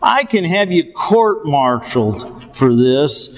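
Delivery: usual speaking voice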